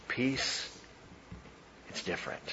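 soft, nearly whispered peace; preacher breathes Jesus' word of peace, whispered; hushed. Acted.

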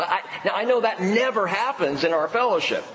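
normal talking voice

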